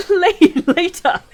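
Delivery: shrill high scream of mocking laughter; rising scream. A narrator's reading. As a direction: shriek it with wild contempt. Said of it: "his voice rose to a scream".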